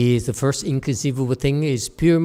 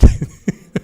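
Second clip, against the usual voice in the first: a short unvoiced laugh; burst of laughter; 10 dB louder than usual. Real, not acted. Laughing at the con, a quick breathy laugh.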